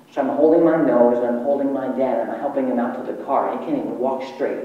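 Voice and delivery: ordinary delivery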